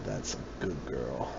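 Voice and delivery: very low, soft rumble with lips barely moving; dad voice. Pretend. Teasing a friend who objects to it, dad voice. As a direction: drop far down into a quiet dad voice. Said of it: "Don't use that dad voice on me".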